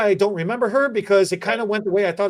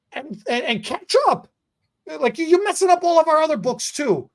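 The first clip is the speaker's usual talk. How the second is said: high mock scolding uncle voice; lightly hammed-up uncle; 10 semitones higher than usual. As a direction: nag like the angry uncle. Uncle voice resumes.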